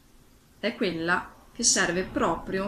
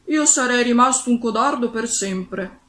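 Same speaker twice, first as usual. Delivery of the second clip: louder, higher read-aloud quote; story narration; 5 semitones higher, 9 dB louder than usual. A narrator's reading. Lion confesses to his friends, emphatic story reading. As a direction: declare it loudly as a storybook line. Reads the lion's line aloud.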